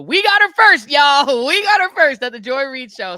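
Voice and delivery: loud, high gloating boast; host bragging they got the interview first; triumphant shout. Real, not acted. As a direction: shout it out like a victory lap. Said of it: "proud boast, shouting".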